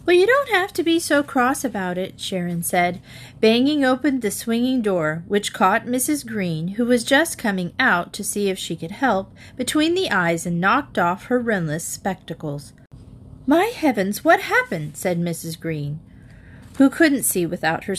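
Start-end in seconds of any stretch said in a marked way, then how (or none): 0.1-2.2 put-on high, huffy childlike retort; sulky little girl. A narrator's reading. Sharon answering her cross mother, sulky child. 13.5-15.0 startled. A narrator's reading. Guest startled.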